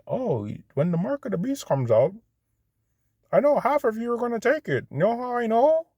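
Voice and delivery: very high-pitched mimicking voice; mocking imitation. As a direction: mimic him in a silly, squeaky-high voice. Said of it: "That's how he talks".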